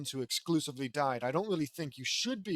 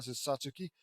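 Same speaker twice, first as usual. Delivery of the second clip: ordinary delivery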